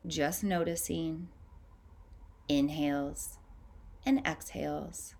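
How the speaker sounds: calm, unhurried meditation voice with long pauses; guided meditation. Acted. Guiding listeners to tune into their breath, calm meditative voice. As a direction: ease the listener into noticing each breath.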